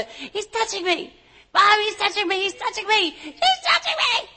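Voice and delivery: put-on high whiny kid tattling to mom; whiny tattling little sibling.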